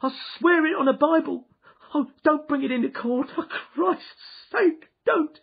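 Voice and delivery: high, loud, crying plea for mercy; shrieked. Acted. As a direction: beg in terror, voice breaking into sobs. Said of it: "he shrieked".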